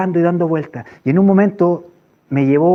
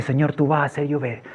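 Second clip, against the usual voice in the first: soft, lower murmured prayer; quiet declaration; 4 semitones lower, 7 dB quieter than usual. Retold or imitated. Man to the Lord after the first drop, hushed. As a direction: say it privately to God in awe.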